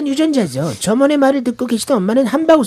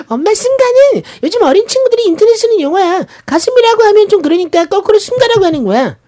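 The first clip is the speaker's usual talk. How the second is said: excited explaining; 10 semitones higher, 5 dB louder than usual. Retold or imitated. Daughter explaining to mom.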